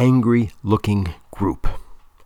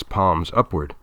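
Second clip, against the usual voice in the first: usual speaking voice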